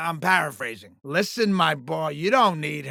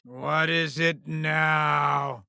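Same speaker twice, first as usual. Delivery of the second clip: very slow, weary, lower-pitched groan of a question; exasperated; 4 semitones lower, 4 dB quieter, much slower than usual. Acted. Boss interrupted by his star yet again, exasperated. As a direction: drag every word out in irritation.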